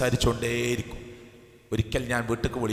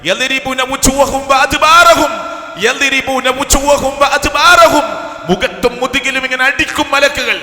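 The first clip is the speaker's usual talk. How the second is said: slow, far higher and louder chanted shout; thundering recitation; 14 semitones higher, 15 dB louder, slower than usual. Acted. Preacher chanting the verse of angels striking the dying, thundering. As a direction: boom the verse out like a warning.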